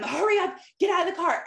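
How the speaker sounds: high, loud rushing command; mock yell. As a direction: push the kid out the door. Mom yelling at child, late.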